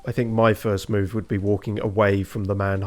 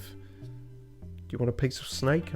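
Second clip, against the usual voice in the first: whispering; 4 semitones higher, 7 dB quieter, much slower than usual. Real, not acted. Aside while sorting items.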